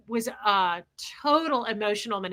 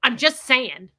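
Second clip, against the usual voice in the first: snippy; 8 dB louder, slower than usual. Retold or imitated. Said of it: "she goes".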